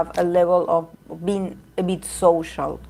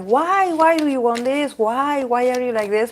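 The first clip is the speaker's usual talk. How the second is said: pitched-up, baffled questioning; mock exasperated dad; 5 semitones higher than usual. Imitating her permissive dad complaining about her wishes, high and exasperated. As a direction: mimic dad's complaining Why, pitched up. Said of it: "he complains".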